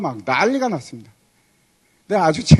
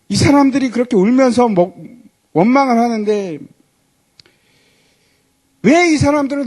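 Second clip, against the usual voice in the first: louder, higher pleading to God; pleading complaint; 4 semitones higher, 5 dB louder than usual. Retold or imitated. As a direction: protest the burden, pleading. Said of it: "하나님께 호소를 합니다". (하나님께 큰 소리로 호소하는 하소연)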